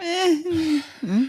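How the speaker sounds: high, grunting mock of Aaron; imitating Aaron. Teasing a co-host by aping his grunts, high. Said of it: "Aaron's like".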